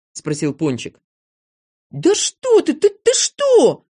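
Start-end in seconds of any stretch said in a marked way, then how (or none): 1.9-3.9 very high, stammering denial; frantic high outcry; flustered protest. A narrator's reading. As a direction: splutter in alarm, loudly. Незнайка flustered by accusation.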